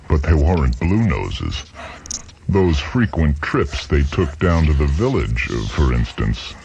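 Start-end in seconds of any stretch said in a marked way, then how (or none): none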